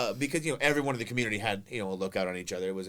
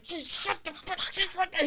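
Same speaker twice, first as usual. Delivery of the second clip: urgent mock hush. Hushing brother urgently.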